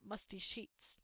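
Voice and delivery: ordinary delivery, nothing marked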